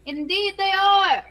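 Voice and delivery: slow, high and loud; grand voice. Acted. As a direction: boom it out grandly. In-character affirmation, repeated.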